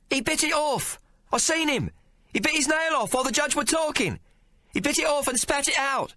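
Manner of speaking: put-on a street kid yelling from the gallery; urchin shouting. Urchin shouting at the court from the gallery, high and excited. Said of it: "A high-pitched voice suddenly shouted".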